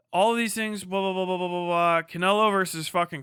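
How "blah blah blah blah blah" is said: ordinary talk, nothing marked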